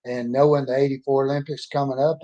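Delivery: ordinary talk, nothing marked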